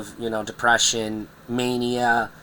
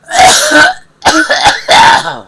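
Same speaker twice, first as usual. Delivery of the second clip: harsh coughing fit; 14 dB louder than usual. Real, not acted. Coughing fit while in pain.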